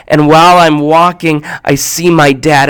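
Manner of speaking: ordinary talk, nothing marked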